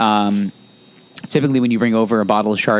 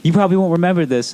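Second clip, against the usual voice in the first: mock dentist voice; 7 semitones higher, faster than usual.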